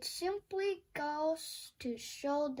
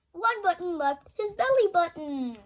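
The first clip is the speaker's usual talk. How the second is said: excited reveal; 3 semitones higher, 8 dB louder than usual. Acted. Surprise reveal of belly button.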